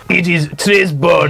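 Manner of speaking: gruff mock-French voice; gruff French accent. Answering what he thinks of the island, gruff French accent. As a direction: growl it like a comic Frenchman. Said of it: "why is he so gruffly?".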